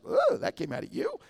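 high, startled mock gasp of a question; shocked onlookers. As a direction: play the shocked bystander. People around you reacting.

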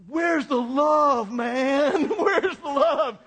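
high, slow, laughing mock plea; imitating the son. Dazed son on the driveway, high slow mock wail. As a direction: play the dazed son whining through a laugh. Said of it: "He kept saying".

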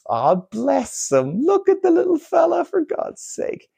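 high, drawn-out fond coo; cooing affection. Acted. Adult pities the harmless little fella, high fond coo. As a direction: coo over a harmless little one, pitch climbing. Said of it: "show him a bit of love".

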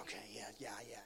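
near-whispered, low going-along reply; barely voiced acted acknowledgement; subdued agreement. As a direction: agree under your breath. Retelling his subdued reply to staff.

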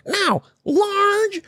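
high, put-on voice of a fussy customer; imitating the lady. Mocking the line cutter ordering at the drive-thru, high put-on voice. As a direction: mimic her rattling off the order. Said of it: "she's going".

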